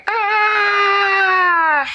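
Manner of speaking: very high, loud falling scream; goblin scream. Acted. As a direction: shriek as you tumble out.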